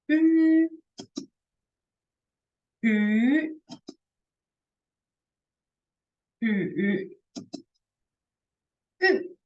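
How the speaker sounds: ü through first, second, third and fourth tones. Shown as a demonstration. Teacher drilling the four tones on ü. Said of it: "last one is".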